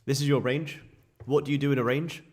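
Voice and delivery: ordinary talk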